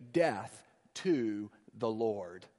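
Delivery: low, slow, hushed imitation of the hostile crowd; mock crowd cry. Preacher quoting the crowd against the Lord, low and slow. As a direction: murmur it like a menacing mob, slowly.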